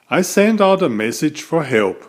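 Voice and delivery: ordinary delivery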